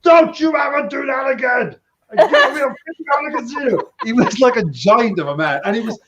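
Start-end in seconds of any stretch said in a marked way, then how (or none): 0.0-3.8 retold scolding. Dealer telling off the NBA player, shouting. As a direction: yell it at the giant player.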